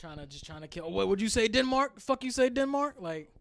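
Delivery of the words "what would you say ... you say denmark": loud, high war-bellow at a rival; hammed-up raider taunt; mock Viking shout. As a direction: yell like a Viking picking a fight. Vikings yelling across at other nations.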